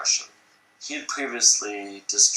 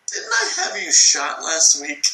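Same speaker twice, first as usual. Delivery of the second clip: slow, higher and louder menacing Stalin voice; mock dictator asking it slowly, pitched up; 7 semitones higher, 6 dB louder than usual. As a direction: act him out as the casually murderous boss. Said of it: "And he'd be like".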